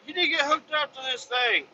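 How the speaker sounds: high nagging worker voice; mock worker. Workers telling driver to hook up, high nagging voice. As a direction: nag like a busy foreman. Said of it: "I get back over there like".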